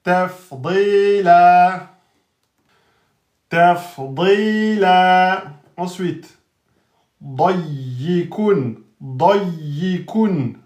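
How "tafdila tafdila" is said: tafdila said slowly, ending on a, no nunation; slow careful recitation; pausal form recited slowly. Shown as a demonstration. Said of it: "À l'arrêt devient tafdila".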